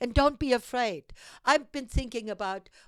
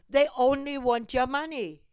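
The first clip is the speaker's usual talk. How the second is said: normal talking voice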